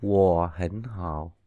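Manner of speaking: "wo hẳn hạo" with low falling tones; third tone as low fall. Shown as a demonstration. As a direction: say "wo" low like dấu huyền. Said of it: "như dấu huyền trong tiếng Việt".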